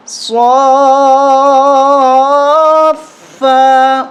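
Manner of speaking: صا and فا called out clearly and drawn; spelled out loud. Shown as a demonstration. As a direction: teach each letter aloud, syllable by syllable. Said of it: "کس طرح پڑھیں گے". (ہجے بلند آواز میں)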